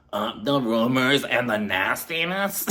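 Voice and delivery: slow, drawn-out Meredith voice with a laugh; laughing slow Meredith imitation; Meredith impression. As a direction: stretch "the rumors and the nastiness" slowly, mimicking Meredith. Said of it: "I believe it sounded something like".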